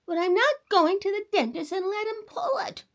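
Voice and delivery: put-on high, loud stubborn child's protest; boy crying out. The boy refusing the dentist, high stubborn cry. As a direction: protest like a scared little boy. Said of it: "cried the boy".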